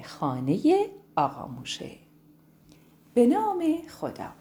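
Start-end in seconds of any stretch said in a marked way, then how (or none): 3.1-4.3 high, clear ceremonial opening; a storyteller's solemn start. Acted. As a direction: open the tale with reverence.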